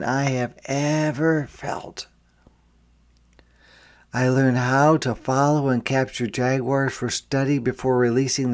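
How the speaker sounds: gentle storybook reading voice; reading to children. A narrator's reading. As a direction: read it like a picture book to kids. Storybook narration.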